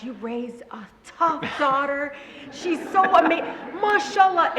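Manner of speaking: high, loud admiring auntie voice; hammed-up delighted praise, rising in pitch; gushing friends. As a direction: gush over someone's daughter. Mother's friends gushing, imitated.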